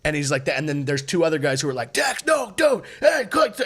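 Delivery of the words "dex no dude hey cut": high-pitched yells to stop a friend; imitated panicked shouting. Two guys pulling Dex from the doorway, imitated panicked shouting. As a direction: imitate frantic guys calling someone off, pitched way up. Friends yelling to restrain enraged man.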